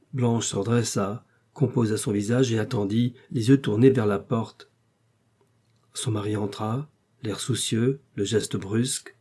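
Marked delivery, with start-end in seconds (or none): none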